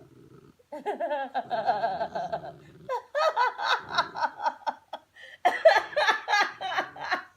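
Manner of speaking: big loud laugh, high and long; laughing hard at the dog's antics; loud laughter. Real, not acted. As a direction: burst out laughing at the dog. Laughing at the dog.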